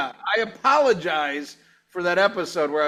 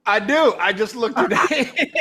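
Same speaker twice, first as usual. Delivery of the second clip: ordinary talk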